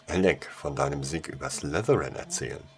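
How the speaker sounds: plain reading voice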